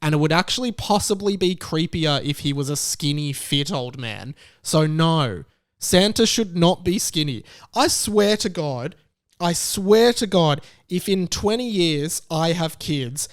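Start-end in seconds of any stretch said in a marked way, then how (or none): none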